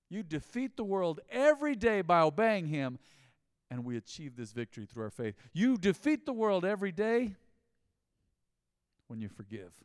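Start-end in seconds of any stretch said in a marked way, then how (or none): 0.1-3.0 loud repeated refrain, pushed hard; preaching refrain. Acted. 5.5-7.4 loud repeated refrain, pushed hard; preaching refrain. Acted.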